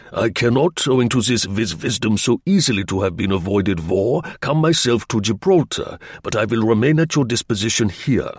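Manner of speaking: put-on German-accented, halting foreign English; stilted German agent's voice; German agent, formal. German agent writing to Slingsby for an interview, accented and formal.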